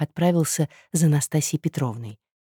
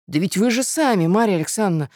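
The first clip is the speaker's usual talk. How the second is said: higher-pitched, protesting amazement; astonished cry; 6 semitones higher than usual. A narrator's reading. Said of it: "в изумлении вскричал Мозгляков".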